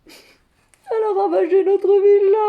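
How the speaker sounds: high, drawn-out comic wail; wailing lament. Acted. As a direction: cry it out slowly, overwhelmed by the disaster. Se lamente sur la villa ravagée. (lamentation éplorée et exagérée)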